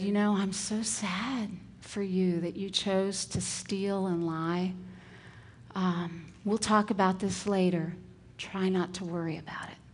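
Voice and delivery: hushed reassuring teacher, kind not scolding; gentle empathy. Retold or imitated. Teacher soothing crying kindergartner, soft and kind.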